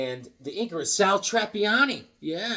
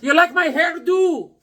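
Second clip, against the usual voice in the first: much higher, louder and slow, hammed-up; proud clerk; 11 semitones higher, 7 dB louder, slower than usual. Clerk Amir showing off his hairdo, high and slow. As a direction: play Amir fishing for praise.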